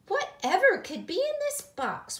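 very high, loud Granny voice; wondering. A narrator's reading. Granny finding a mystery box, high wondering voice. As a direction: puzzle over it with exaggerated curiosity. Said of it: "wondered Granny".

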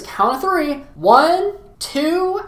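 high, teasing mock countdown; counting clown. Clown counts to three for the girl, high showy countdown.

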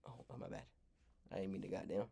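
normal talking voice

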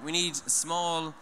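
ordinary talk

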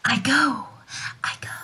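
breathy whispered I go; whispered exit. Acted. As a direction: whisper it as you vanish. Ariel hurries off invisible.

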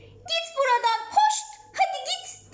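very high-pitched yelled command; shouting. A narrator's reading. Ayşegül driving off the wild dog, high-pitched shouting. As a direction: shoo it away in a high cry. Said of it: "diye bağırdı".

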